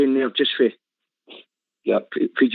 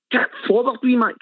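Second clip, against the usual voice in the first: higher, urging call to a winger; mock yell from the stands; 7 semitones higher than usual. Fan retelling how he yelled at the winger, a mild mock yell. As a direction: shout him forward from the terraces. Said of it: "screaming it".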